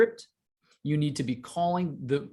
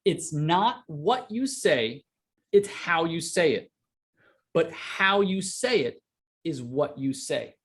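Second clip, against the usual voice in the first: slow, weighty delivery with pauses between each phrase; slow emphatic repeat; slower than usual. Shown as a demonstration. Coach repeating his saying to students slowly for emphasis. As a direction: let each phrase land before the next. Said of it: "I'm gonna repeat that".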